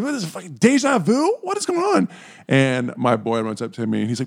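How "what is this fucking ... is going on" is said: high-pitched mock panic; acted disbelief. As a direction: squeal it in disbelief. Acts own panicked disbelief.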